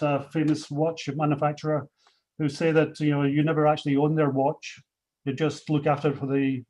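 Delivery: normal talking voice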